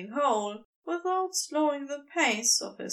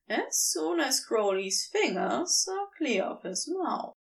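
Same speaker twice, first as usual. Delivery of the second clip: plain reading voice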